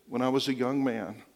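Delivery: soft and low, full of regret; quietly remorseful old man; regretful confession. Retold or imitated. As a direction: confess a lifetime of saying no. Dying man's regretful confession.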